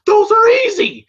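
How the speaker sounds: loud, high mock yell; heated retort. Mickey dismissing Rocky's title defenses, mock yell. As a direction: fire back as an exasperated trainer. Acts out Mickey arguing back.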